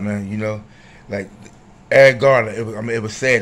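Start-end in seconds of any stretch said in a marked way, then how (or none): 1.9-2.5 loud emphatic name. Acted. Name said loudly.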